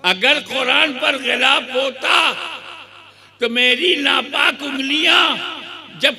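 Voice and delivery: high, loud pulpit voice building the story; fiery preaching. Acted. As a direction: preach it to the crowd at full force. Preaching at full force.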